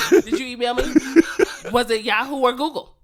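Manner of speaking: laughing through the question; can barely ask it for giggling. Retold or imitated. Repeats quote while laughing.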